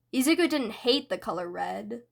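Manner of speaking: usual speaking voice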